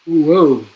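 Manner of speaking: loud yawn that breaks into a whoa. Real, not acted. Host yawning on air during preview, real loud yawn. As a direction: let a big yawn escape mid-sentence. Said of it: "involuntary yawn there".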